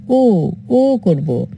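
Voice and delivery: the sound ও stretched very slowly; slow clear ও; drawn-out o. Shown as a demonstration. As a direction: hold the vowel ও long and slow. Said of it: "উচ্চারণ কি করব?".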